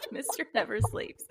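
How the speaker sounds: laughing while repeating; giggly amused repeat. Real, not acted. Laughing at silly username.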